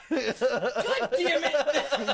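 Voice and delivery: loud laughing outburst. Real, not acted. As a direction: blurt it out through a laugh. Caught out, laughing loudly.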